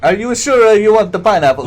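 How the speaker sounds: high, loud voice of a doubtful pizza guy; imitating the pizza guy's funny voice on the phone. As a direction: put on his funny voice. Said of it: "that's really how he talked".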